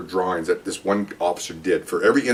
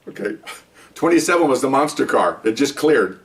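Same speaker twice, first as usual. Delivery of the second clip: ordinary talk, nothing marked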